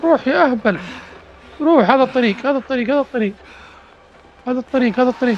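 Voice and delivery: high, urgent calls to go this way; pushing his character to run, breathless urgency; frantic directing. Real, not acted. Gamer steering his fleeing character, high and frantic.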